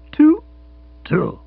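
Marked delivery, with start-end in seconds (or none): 0.1-0.4 very high, loud startled "Two?". Acted. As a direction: yelp the question in shock. Startled echo of number.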